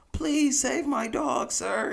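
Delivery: high, drawn-out parody of a desperate dog owner; mock pleading movie victim. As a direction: beg the firefighter like a corny film extra. Movie scene, begging firefighter.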